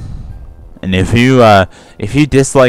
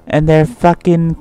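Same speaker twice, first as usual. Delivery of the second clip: put-on Sam's Jersey accent; 8 semitones higher than usual. Mocking Sam as Philly-obsessed, put-on Jersey accent. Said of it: "put on the fucking Jersey accent".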